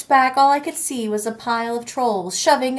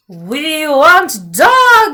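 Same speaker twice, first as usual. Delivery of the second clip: loud, slow, higher troll roar; troll bellow; 5 semitones higher, 8 dB louder, much slower than usual. Acted. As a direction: stretch it out like hungry trolls. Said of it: "they bellowed and ran towards us".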